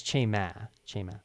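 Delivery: "chema" with stress on "che", "ma" light; first syllable stress. Shown as a demonstration. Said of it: "emphasis is always on the first syllable".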